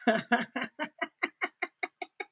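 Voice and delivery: long, high, soft chuckle; laugh. Real, not acted. Streamer laughs about the removed video, chuckling. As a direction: chuckle in a steady run of short bursts.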